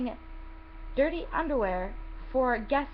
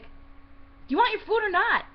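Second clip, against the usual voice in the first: high, drawn-out mock exasperation; hammed-up indignant question; exasperated retort; 10 semitones higher, 4 dB louder, slower than usual. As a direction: throw it back at an impatient customer, pitched way up. Retort to impatient customer.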